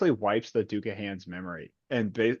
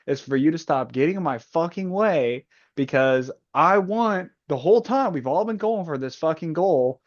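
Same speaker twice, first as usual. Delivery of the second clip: raised, exasperated mock tirade; Mustang rant; 6 semitones higher, 3 dB louder than usual. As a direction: bark orders like a fed-up boss. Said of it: "And she's like".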